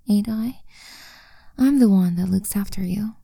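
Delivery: ordinary delivery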